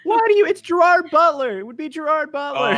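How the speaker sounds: fed up with the joke names, let it climb; exasperated outburst. Real, not acted. Player exasperated at friends' silly names, loud high protest.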